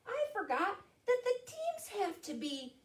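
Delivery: high little mouse voice; high-pitched storybook mouse; squeaky mouse. Acted. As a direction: pipe up high as a small mouse. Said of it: "squeaked Mouse".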